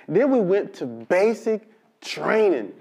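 ordinary talk, nothing marked

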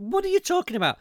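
high-pitched incredulous question; outraged disbelief. Real, not acted. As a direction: squeak of disbelief. Outrage at the excuse.

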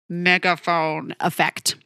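hollow, slow, through a cupped hand; toy megaphone. Shown as a demonstration. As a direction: speak into your hand like an old megaphone. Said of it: "putting my hand up to my mouth".

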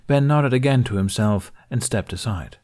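usual speaking voice

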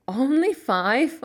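slow, amused question; laughing. Acted. Dickon amused by Mary's count, slow and laughing. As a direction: tease her gently with a laugh in it. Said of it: "laughed Dickon".

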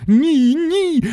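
very high, squealed refusal; piercing, stretched shriek; screaming back. A narrator's reading. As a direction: squeal it back over the din. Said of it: "верещав у відповідь містер Вонка".

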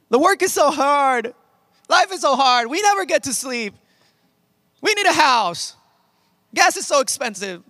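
high, whiny litany of grievances; self-mocking complaint. Pastor imitating own griping to the Lord, mock whining. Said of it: "I literally heard myself being like".

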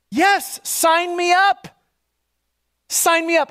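high, drawn-out eager yes; eager acceptance. Acted. Desperate hearer answers the voice, eager and high. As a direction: jump at the offer, pitched high.

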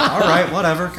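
high, loud and laughing; mock-defensive brush-off through laughter; laughing comeback. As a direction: retell a huffy reply while cracking up. Retold comeback, while laughing.

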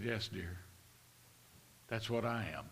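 low, soft, tender reply; gentle reassurance. Retold or imitated. Kind man answering a crying waitress, gently reassuring. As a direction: answer softly and low, with kindness.

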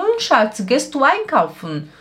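high-pitched, lightly acted sweet question; affectionate call to husband. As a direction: call to your husband as he gets up to leave. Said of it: "eu olho pro meu marido". (chamando o marido de amor, voz aguda)

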